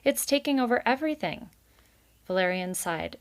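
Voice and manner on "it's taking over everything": put-on high, fast child complaint; a kid rushing out a grievance; child objecting. A narrator's reading. Child complains to father about pond, fast and high.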